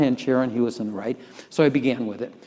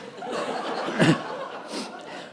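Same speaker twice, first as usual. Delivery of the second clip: a quick breathy chuckle; airy laugh; 4 semitones higher, 4 dB quieter than usual. Real, not acted. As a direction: chuckle at the absurdity. Laughing at the new-job line.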